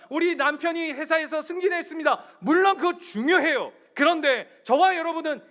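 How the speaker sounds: very loud, high preaching climax; fiery preaching. Acted. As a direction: preach it at full force to the congregation. Preaching at full force.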